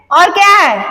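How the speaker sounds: ordinary delivery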